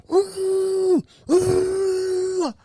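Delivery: high, stretched hooting wail; high drawn-out mock howl; mock screaming. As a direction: imitate a patient howling through his hand. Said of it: "he's screaming so loud".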